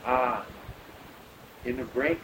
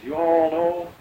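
imitating a North Carolina drawl, a touch louder; 5 semitones higher, 9 dB louder, slower than usual. Retelling the pilot's words in a North Carolina drawl. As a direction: lean into a Southern drawl on you all. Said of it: "you know, the North Carolina drawl".

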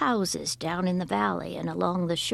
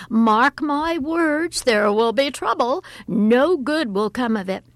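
alarmed mother foretelling trouble; alarmed warning; 5 semitones higher, 7 dB louder than usual. A narrator's reading. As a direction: warn with dramatic alarm. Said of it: "cried her mother in alarm".

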